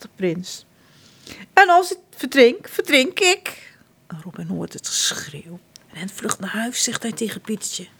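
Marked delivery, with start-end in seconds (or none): 1.5-3.8 high, whiny insistence; petulant prince. Acted. Prince John insisting to Slis he is drowning, whiny. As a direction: insist like a spoiled child.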